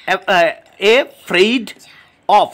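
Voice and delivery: afraid of broken as a, fraid, of; syllable by syllable. Shown as a demonstration. Teacher counting three syllables, each said separately. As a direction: pause between each part of afraid of. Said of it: "তিনটে এখানে syllable রয়েছে".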